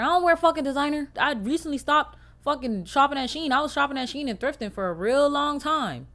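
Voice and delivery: worked-up complaint about clothes; heated rant. Real, not acted. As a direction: vent it hard and fast. Ranting about designer clothes.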